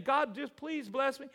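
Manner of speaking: high, loud, drawn-out plea; mock pleading. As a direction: beg in an exaggerated, high, slow voice. Voicing a congregant pleading for blessing.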